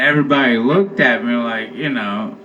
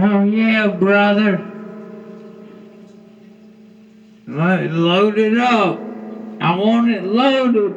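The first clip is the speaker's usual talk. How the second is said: higher, eager voice, dragging the order out; hammed-up customer who wants it all loaded; mock fast food customer; 7 semitones higher than usual.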